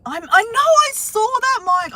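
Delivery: very high squeaky elf puppet voice; chirpy puppet calling out a name. Pretend. Elf voice again for Mike.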